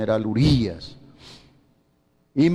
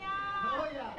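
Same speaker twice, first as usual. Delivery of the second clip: high laugh; 13 dB quieter than usual. Real, not acted. Preacher laughs mid-story.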